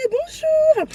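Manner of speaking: very high, drawn-out hello; mock greeting. Speaker greeting her own hypersensitivity, mock-cheery high voice. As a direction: sing out a squeaky ironic hello.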